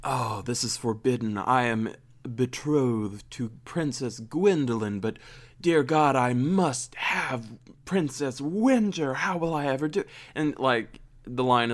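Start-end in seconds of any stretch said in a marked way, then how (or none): 0.0-10.0 slow, overwrought lovesick prince; mock dramatic prince. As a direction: ham up a tortured romantic hero. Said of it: "Esteban basically is like".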